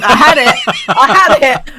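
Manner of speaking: loud laughing outburst; laughing exclaim. Real, not acted. Frustrated at losing the word.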